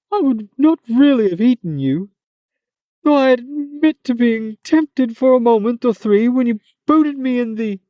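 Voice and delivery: a hammed-up high monster; Manticore voice. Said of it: "this funny Manticore voice".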